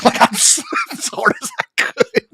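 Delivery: high, squeaky words through laughter; slow, helplessly laughed-out words; real laughing. Real, not acted. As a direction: lose it mid-sentence, voice shooting up. Laughs at own story.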